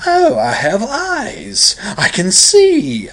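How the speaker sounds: high, slow, swooping posh English wonder; theatrical mock upper-class English rapture. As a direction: marvel in a hammed-up upper-crust English accent. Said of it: "He starts doing that".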